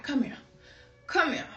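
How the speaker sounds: lightly voiced stern grandmother; a hint of stern old-lady command; imitated stern granny. Great-grandmother summoning a scared child, mildly stern imitation. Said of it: "she had that stern look".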